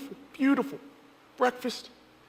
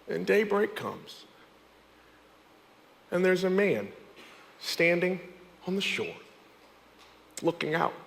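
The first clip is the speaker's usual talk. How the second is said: hushed storytelling; 5 semitones lower, 5 dB quieter than usual. A narrator's reading. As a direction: draw them in quietly. Hushed scene setting.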